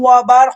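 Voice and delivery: বার hit loud with a stopped sakin ending; pronunciation demo. Shown as a demonstration. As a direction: say ওয়া, বার sharply, stopping on বার. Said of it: "এভাবে উচ্চারণ করতে হবে". (বার জোরে সাকিন ধরে উচ্চারণ)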